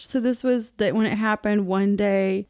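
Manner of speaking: ordinary talk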